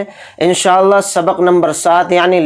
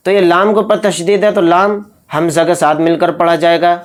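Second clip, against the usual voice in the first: ordinary delivery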